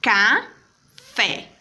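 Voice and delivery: "café" broken into two separate syllables; counting out the syllables of "café". Shown as a demonstration. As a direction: say "café" slowly as ca, pause, fé. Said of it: "Quando eu pronuncio a palavrinha".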